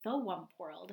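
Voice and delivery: ordinary talk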